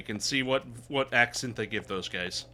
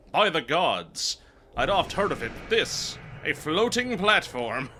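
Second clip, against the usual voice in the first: acted amazement; 4 semitones higher, 4 dB louder than usual. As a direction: exclaim like an awestruck knight in a game. Acting amazed knight reading game dialogue.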